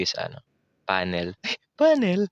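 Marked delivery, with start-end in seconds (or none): 1.8-2.3 playful echo. Pretend. Word repeated in exaggerated voice. (pabirong matinis na boses)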